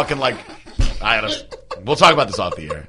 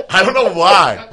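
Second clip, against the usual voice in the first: laughing; 6 semitones higher, 4 dB louder, slower than usual. Real, not acted. Cracking up at own story.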